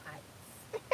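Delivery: usual speaking voice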